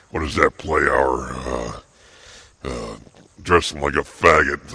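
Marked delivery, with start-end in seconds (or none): none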